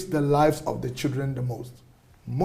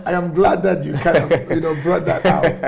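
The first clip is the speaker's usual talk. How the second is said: chuckling through the words; laughing; 5 dB louder than usual. Real, not acted. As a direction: laugh as you agree, pleased. Pleased, laughing reply to host.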